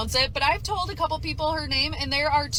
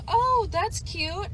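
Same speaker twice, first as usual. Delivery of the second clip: sweet friend voice; 8 semitones higher, much slower than usual. Retold or imitated. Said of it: "she goes".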